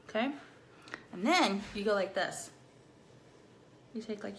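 ordinary talk

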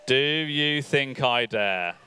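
normal talking voice

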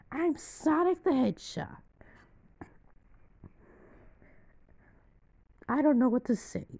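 cartoon hedgehog squeak; Dreamcast Sonic voice. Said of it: "We're gonna use his Dreamcast voice".

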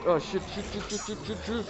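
high panicked swearing; real panic, rapid curses. Real, not acted. Enemy attacks, panicked swearing.

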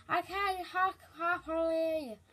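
slurred gummy toothless words; toothless lisp. Acted. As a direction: mash the words without teeth. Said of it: "made my teeth fall out".

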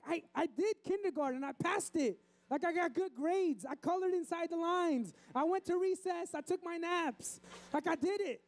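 put-on high, protesting child voice; crying kid. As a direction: plead your case like an upset first grader. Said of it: "and he's crying".

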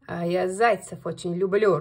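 low, loud wolf, leaning on люблю; menacing wolf. Acted. Wolf tells the hare he loves hares, low and loud. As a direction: growl it, stretching люблю.

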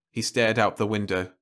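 ordinary delivery

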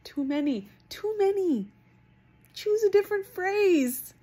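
fed-up squeal of complaint; exasperated outcry. Real, not acted. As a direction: throw up your hands at the phrase. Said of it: "how I hate that phrase".

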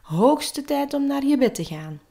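high-pitched parental call; father calling out. A narrator's reading. Father to son at bedtime, calling out. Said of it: "Toen riep zijn vader".

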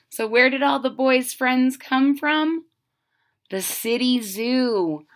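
plain reading voice

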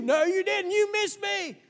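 put-on high loud childish taunt; kid protesting. A boy tells his playmate he missed, high defiant shout. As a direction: insist like a stubborn kid at play. Kid arguing during play.

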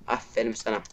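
soft, sour "Aferin sana" muttered; real resigned bitterness; quiet sarcasm. Real, not acted. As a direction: drop the praise dry and defeated.

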